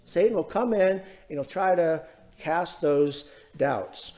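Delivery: ordinary delivery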